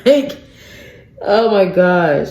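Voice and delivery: high, laughing "like"; real laugh bubbling into the word; incredulous laughing. Real, not acted. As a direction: break into disbelieving laughter. Laughs in disbelief at the story.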